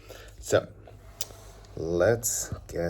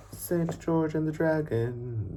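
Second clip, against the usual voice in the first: title sung out much higher; playful high title; 11 semitones higher, slower than usual. Shown as a demonstration. Teacher announcing the book title high and playful. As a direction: call the title out high and bright to the kids. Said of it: "And one more".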